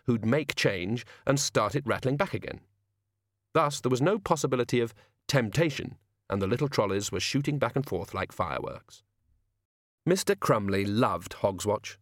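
plain reading voice

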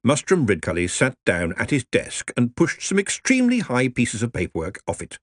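plain reading voice

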